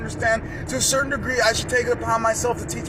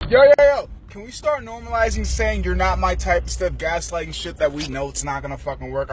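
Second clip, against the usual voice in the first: ordinary talk, nothing marked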